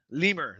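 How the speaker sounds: Lemur said "LEE-mer", stressed up front; animal-name Lemur. Real, not acted. Streamer corrects himself, Lemur as LEE-mer. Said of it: "It's just a lemur".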